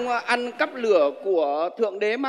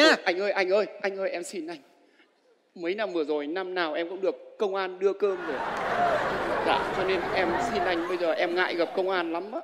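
softer, lower begging; pleading softly; 4 semitones lower, 7 dB quieter than usual. Acted. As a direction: beg quietly for mercy. Thief begs homeowner.

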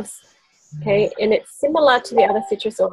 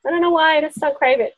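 high, carefree and content; lightly acted contented voice; breezy satisfaction; 8 semitones higher than usual. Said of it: "now it's like".

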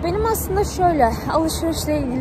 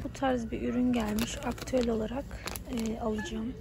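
quiet at night; 9 dB quieter than usual. Real, not acted. Talking softly inside the shop.

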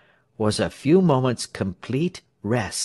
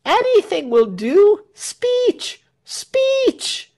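very high, insistent calls; crowd clamour; 5 dB louder, much slower than usual. A narrator's reading. Crowd pressing Tell for a speech, clamouring.

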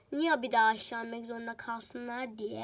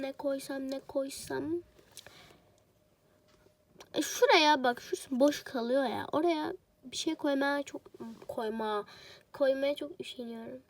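ordinary talk

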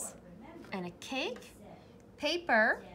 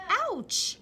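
a sudden high, louder yelp; jolted by a nip; startled ouch; 10 semitones higher, 6 dB louder than usual. A narrator's reading. Bunny nipped on the tail, startled high yelp. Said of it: "Bunny was startled".

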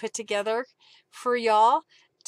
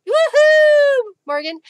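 high, loud whoop; loud delighted woohoo; cheer; 12 dB louder, slower than usual. Real, not acted. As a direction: let out a happy whoop. Cheering for Morgan.

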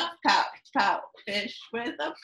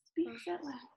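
hushed suspense; 4 semitones higher, 10 dB quieter than usual. A narrator's reading. Suspenseful storytelling hush.

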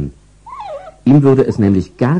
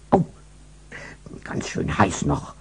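whispered; 3 semitones lower, 10 dB quieter than usual. Acted. Dog burns its snout.

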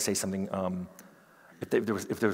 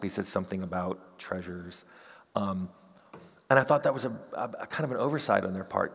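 ordinary talk, nothing marked

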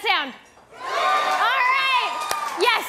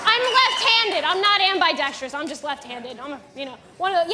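ordinary delivery

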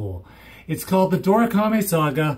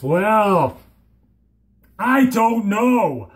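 normal talking voice

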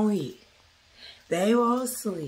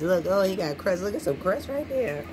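ordinary delivery

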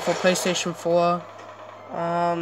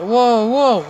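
loud, raised double whoa; startled whoa; 4 semitones higher, 7 dB louder than usual. Real, not acted. Player surprised by enemy fire, startled exclamation. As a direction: exclaim it, taken aback.

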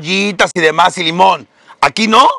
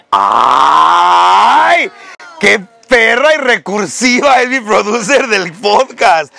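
mock outrage shout; 3 semitones higher, much slower than usual. Real, not acted. Host shouting at her podcast producer in mock outrage. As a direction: holler your fake outrage.

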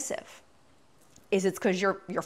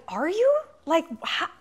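incredulous question; 7 semitones higher than usual. Retold or imitated. Mock quote of listener.

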